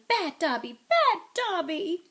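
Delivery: building to a high, loud shout; high self-scolding elf shout. A narrator's reading. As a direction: punish yourself out loud.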